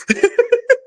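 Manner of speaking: high-pitched giggle; laugh. Real, not acted. Streamer joking with chat about French, giggling. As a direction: burst into a short high laugh.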